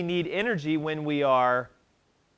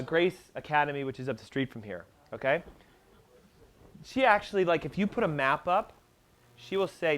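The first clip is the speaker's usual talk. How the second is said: usual speaking voice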